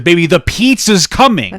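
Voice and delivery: loud, slow, exasperated warning; mock-furious reminder about the pizza; angry retelling. Husband retelling scolding his wife over popcorn, loud and slow. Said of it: "'Cause I was so angry".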